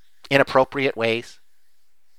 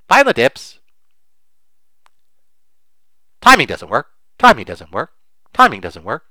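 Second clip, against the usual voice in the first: dismissive mantra; 6 dB louder than usual. Retold or imitated. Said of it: "And we say".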